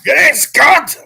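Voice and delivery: loud, high Scottish shout; Groundskeeper Willie. Acted. Co-host imitating a cartoon groundskeeper, shouted Scottish accent. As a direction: holler it like a cartoon Scotsman. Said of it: "Willie the groundskeeper".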